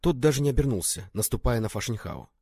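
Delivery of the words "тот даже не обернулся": usual speaking voice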